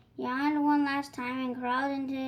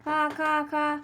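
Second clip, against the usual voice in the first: crow caw; 2 semitones higher, 5 dB louder, slower than usual. A narrator's reading. Crow cawing read aloud.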